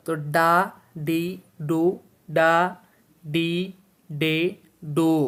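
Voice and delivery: ডা ডি ডু ডা ডি ডে ডো, each syllable crisp and separate; syllable drill. Shown as a demonstration. Teacher drilling students on ড syllables, crisp and separate. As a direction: drill the vowel series one syllable at a time. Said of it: "উচ্চারণ".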